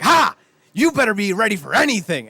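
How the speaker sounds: loud, high shout springing a trick; triumphant gotcha. Acted. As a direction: imitate a dad crowing over his prank. Dad springs his fake-collapse lesson.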